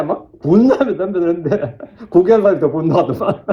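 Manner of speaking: laughing through the account; amused, laughing. Real, not acted. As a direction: let chuckles break into the retelling. Amused account of angry audience. (웃음 섞인 말투)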